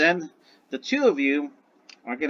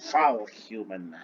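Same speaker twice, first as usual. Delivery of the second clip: booklet reading; 4 semitones lower, much slower than usual. Acted. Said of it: "he's struggling to read it".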